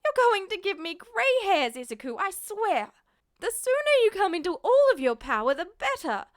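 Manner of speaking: high, loud exasperated scolding; exasperated mother. Acted. Mother exasperated with her reckless son, scolding. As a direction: vent the exasperation.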